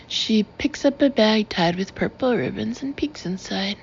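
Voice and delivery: ordinary delivery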